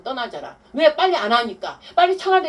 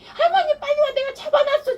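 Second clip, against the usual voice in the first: put-on much higher and louder urgent child voice; excited little boy; 5 dB louder than usual. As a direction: call grandma like a worked-up kid who caught someone. Grandson urgently calling grandma over. (할머니를 부르는 손자, 아주 높고 큰 흥분한 아이 목소리)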